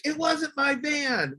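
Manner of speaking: high, slow mock protest; overdone denial, drawn out; playing it up. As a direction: insist it wasn't yours, hammed-up. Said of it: "I'll play it up".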